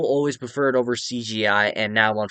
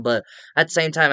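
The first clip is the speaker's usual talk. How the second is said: ordinary talk, nothing marked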